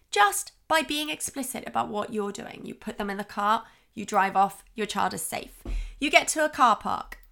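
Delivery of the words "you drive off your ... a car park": ordinary delivery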